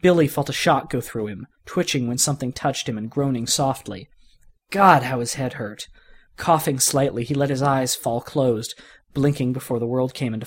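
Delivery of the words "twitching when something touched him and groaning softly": usual speaking voice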